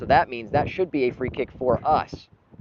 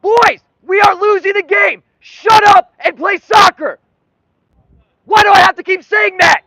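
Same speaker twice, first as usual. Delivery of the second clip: screaming rage at the players, much higher and louder; furious shout; 15 dB louder than usual. Real, not acted. Streamer screaming at his losing team, furious. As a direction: yell down at the team like a furious coach.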